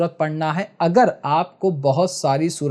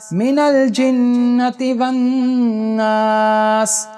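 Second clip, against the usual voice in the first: Quran recitation; 4 semitones higher, much slower than usual. Shown as a demonstration. Teacher reciting a surah for students to hear, chanted slowly. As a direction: sing out each verse with long held vowels. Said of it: "سنو".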